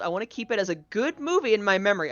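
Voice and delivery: usual speaking voice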